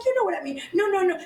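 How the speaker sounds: high-pitched mock protest; flustered insistence. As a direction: imitate her hastily defending herself. Acting out her flustered backpedalling.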